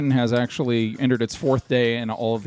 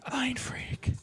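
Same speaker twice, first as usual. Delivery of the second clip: breathy whispered Mind freak; whispered catchphrase; 12 semitones higher, 10 dB quieter than usual. Retold or imitated. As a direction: whisper it like a secret. Quoting magician catchphrase, whispered.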